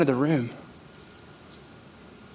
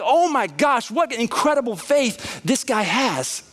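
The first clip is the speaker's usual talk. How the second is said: mock amazement; 7 semitones higher, slower than usual. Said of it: "you're like".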